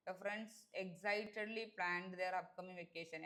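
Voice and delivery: very slow, deliberate read with excitedly clear; slow corrected reading. Shown as a demonstration. Teacher reading the corrected sentence very slowly to students. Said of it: "Excitedly എന്നാണ്".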